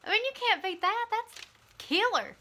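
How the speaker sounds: can't believe how cool this is; excited. Real, not acted. Vlogger telling viewers her walking goal, delighted.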